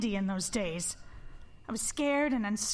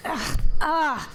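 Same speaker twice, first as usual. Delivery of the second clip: exasperated groan; 4 semitones higher, 9 dB louder than usual. Acted. Exasperated outburst at Peter.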